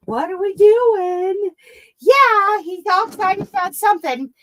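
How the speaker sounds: high sing-song pet talk. Real, not acted. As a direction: gush at the dog in a squeaky voice. Pet talk to excited dog.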